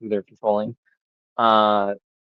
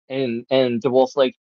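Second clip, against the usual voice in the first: usual speaking voice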